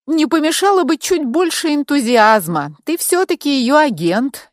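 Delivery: a touch of mock reproach; snide. A narrator's reading. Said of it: "ехидно вставила Яна".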